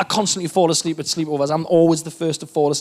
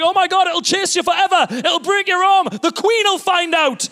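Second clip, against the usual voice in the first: panicked; 10 semitones higher than usual. Retold or imitated. Comedian retelling his panic at the swan grab, high and frantic. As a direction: squeal the warnings in a panic. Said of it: "I'm like".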